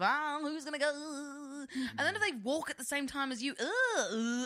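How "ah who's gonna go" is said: hesitant dithering. Retold or imitated. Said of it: "social politics of".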